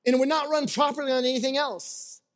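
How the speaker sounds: a playful British lilt for the quote; mock British accent. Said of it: "He's British".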